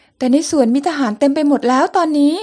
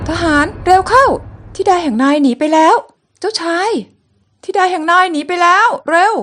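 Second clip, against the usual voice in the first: alarmed shouting; 3 semitones higher, 4 dB louder than usual. Acted. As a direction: raise the alarm that she has fled. Soldiers alarm, she escaped.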